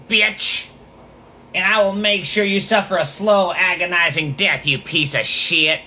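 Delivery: sneering parody of a violent troll; mock menacing threat. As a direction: ham up the online tough guy's threat. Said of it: "So he's saying".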